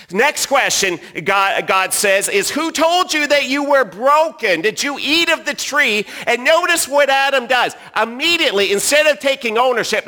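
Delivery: loud, high preaching voice; full-force preaching. Acted. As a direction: preach it out to the whole room. Preaching on shame and blame.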